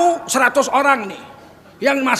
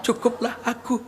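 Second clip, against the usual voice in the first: ratapan pura-pura; 2 semitones lower, 5 dB quieter than usual. Acted. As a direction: play a long-suffering wife, weeping in broken phrases. Istri meratapi nasib, diperagakan. (meratap pelan dibuat-buat)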